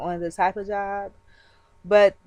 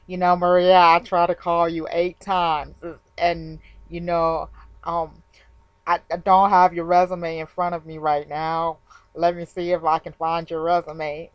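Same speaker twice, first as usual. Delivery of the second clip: sluggish recruiter; 4 semitones lower than usual. Retold or imitated. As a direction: lightly drag it like the drowsy recruiter. Recruiter on the phone again.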